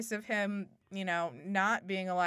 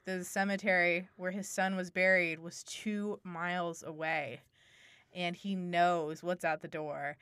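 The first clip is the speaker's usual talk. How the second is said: ordinary delivery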